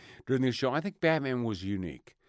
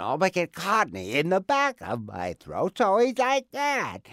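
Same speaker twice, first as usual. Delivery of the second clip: muffle it, cottony at the back; cottony throat voice; 7 semitones higher, 4 dB louder than usual. Shown as a demonstration. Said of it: "Oh, placement".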